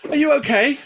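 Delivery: high, loud imitation of a fussing stranger; concerned woman. Woman running over asks if he's hurt, high loud worried voice. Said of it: "this woman comes running over and she goes".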